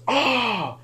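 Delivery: high-pitched retold scream; quick mock scream; imitated scream. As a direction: mimic an overheard "Ah!" up high. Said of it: "all I heard was".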